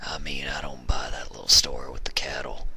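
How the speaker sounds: put-on low, hushed old stallion, doubtful; hushed old hunter calling a bluff; whispery old stallion. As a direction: call her bluff quietly. Narrator voices old stallion.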